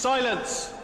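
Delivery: high, booming call for silence; commanding cry. Acted. Herald silencing the opera audience, booming high call.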